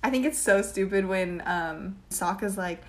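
ordinary talk, nothing marked

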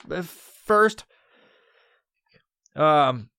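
ordinary delivery

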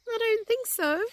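high put-on disguised voice; Rabbit. A narrator's reading. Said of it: "in a different sort of voice this time".

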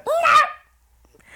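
high, loud hammed-up wailing "No!"; mock hysterical shout. Wife mimicking husband demanding to talk, hammed-up shouting. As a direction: wail it like a tantrum, laughing. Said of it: "And I'm just like".

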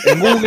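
"Google" read as Spanish "GO-gleh"; dad's mispronunciation. Retold or imitated. Imitating his dad's way of saying it, "Google" as "GO-gleh". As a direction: say "Google" with a spelled-out final -le. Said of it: "va a ser como dice mi viejo".

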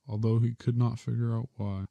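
usual speaking voice

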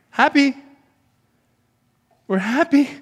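high, loud sarcastic happy; loud mock cheer. Real, not acted. Preacher mocking the humanists with a loud sarcastic cheer. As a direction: shout the word like a mocking cheer.

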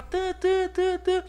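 high imitated horn beeps; mock honking. Drivers honking, high imitated beeps. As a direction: beep like a car horn.

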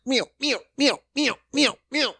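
high meow meow for a scurrying lady; mock meowing. Mimicking the old waitress.

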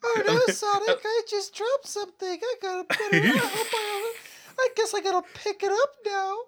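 high giggly coy cartoon character. Friend playing Tails in fanfic riff, high giggly voice. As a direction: squeak it out like a flustered cartoon.

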